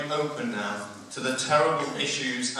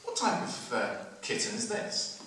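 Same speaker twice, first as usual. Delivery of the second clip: Osborne voice; 8 semitones higher, 7 dB quieter than usual. Retold or imitated. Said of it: "and he says".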